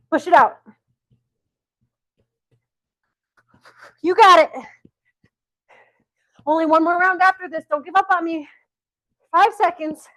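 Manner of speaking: high, loud urging; coach's shout. Real, not acted. Coach urging the class through a sprint, shouting. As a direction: push them through the last seconds, loud.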